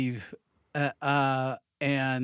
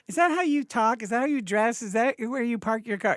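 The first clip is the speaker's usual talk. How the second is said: judgmental questioning; 10 semitones higher, 3 dB louder than usual. Retold or imitated. Mock judgmental person asking pointed questions.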